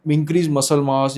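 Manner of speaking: ordinary delivery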